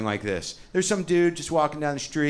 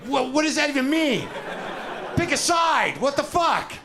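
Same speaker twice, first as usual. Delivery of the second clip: high, squeaky exasperated voice; mocking exasperated questioner; mock annoyed believer; 12 semitones higher than usual. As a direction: play the huffy believer demanding you pick a side.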